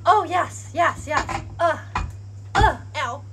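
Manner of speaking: startled. Real, not acted. Startled by the fire.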